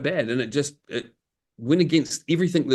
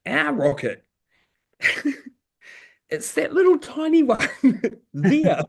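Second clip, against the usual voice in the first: higher voice, chuckling through a mock sheepish admission; higher, laughing imitation of the client; imitating the engineer; 12 semitones higher than usual. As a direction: parody him pointing at the tiny part, half laughing. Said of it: "He said".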